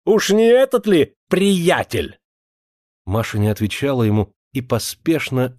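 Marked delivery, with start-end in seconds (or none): 0.0-2.2 high, drawn-out sneering cry; angry taunting shout. A narrator's reading. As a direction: fling the taunt after someone leaving. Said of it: "закричал ей вслед Авдей".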